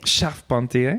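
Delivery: Charpentier copied in French; French pronunciation. Shown as a demonstration. Host copies guest's correction, French pronunciation of Charpentier. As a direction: echo "Charpentier" with a throaty French r. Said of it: "There we go".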